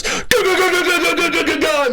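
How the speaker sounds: very high, rapid battle chant of go; gunning Jesus. Retold or imitated. Retelling Jesus wiping out enemies, high frantic go chant. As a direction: rattle it off like a gung-ho commando. Jesus opens fire in game.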